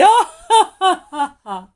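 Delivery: loud, high belly laugh; loud laughter. A narrator's reading. Sun rolling over laughing, loud laugh. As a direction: burst out laughing. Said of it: "rolled over laughing".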